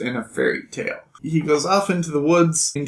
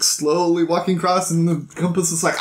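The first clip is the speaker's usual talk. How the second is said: usual speaking voice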